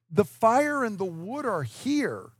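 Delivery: a son lightly voiced, working something out. Isaac questioning his father on the way up, lightly voiced son. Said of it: "Isaac said".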